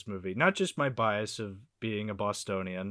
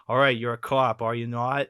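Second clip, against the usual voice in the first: mock Boston accent; 8 dB louder than usual. Imitating Martin Sheen's movie role in a loud Boston accent. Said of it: "like, this thick Boston accent".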